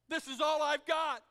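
a full shout, clinging and desperate. Acted. Preacher voicing a soul clinging to its past, shouting. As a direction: throw it out as a cry of holding on. Clinging to old identity, repeated.

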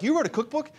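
high-pitched mock disbelief; puzzled friend, voice shooting way up; incredulous question. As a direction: imitate a friend's astonished question, pitch leaping up. Friend's surprised question retold.

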